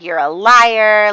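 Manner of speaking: slow, loud accusation; accusing. Acted. Tiffany to her sister at the party, slow loud accusing. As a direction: spit out the accusation.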